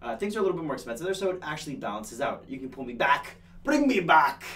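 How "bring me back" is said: loud call to the crew, a touch higher. Real, not acted. Host calling loudly to the producer off camera. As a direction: shout it off camera to get pulled back.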